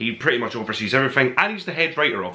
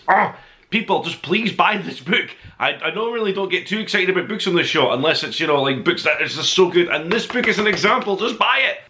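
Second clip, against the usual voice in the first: higher, pleading excitement; excited outburst; 7 semitones higher than usual. Real, not acted. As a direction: gush at the viewers, begging them to buy. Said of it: "now that I've calmed down".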